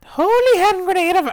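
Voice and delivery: very high, over-the-top Python voice; piercingly high mock scripture; high-pitched Holy Grail voice. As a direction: squeal it out like a Python cleric. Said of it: "Their high-pitched voice".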